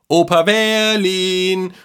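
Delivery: high, loud, drawn-out call. A narrator's reading. Boy calls to grandpa from the hallway, high and stretched. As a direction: sing out the name in greeting. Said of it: "rief er ihm entgegen".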